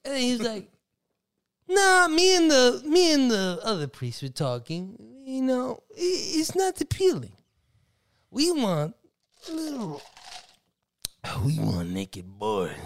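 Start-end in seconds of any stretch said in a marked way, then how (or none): 1.7-12.7 mock priest voice. Retold priest voice.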